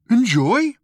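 high, loud baffled boom. A narrator's reading. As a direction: boom it as a dim guard's question. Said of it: "he boomed".

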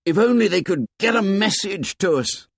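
put-on high, urgent boyish plea; high-pitched, yearning outburst; desperate cry. A narrator's reading. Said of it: "cried Ralph desperately".

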